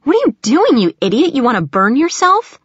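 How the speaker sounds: high, loud exasperated outburst; alarmed scolding. A narrator's reading. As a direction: scold a child away from danger. Sister scolds boy at hot oven.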